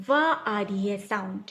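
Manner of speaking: ordinary talk, nothing marked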